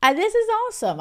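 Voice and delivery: high-pitched excited exclaim; thrilled. Retold or imitated. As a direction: gush with sudden joy. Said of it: "I'm like".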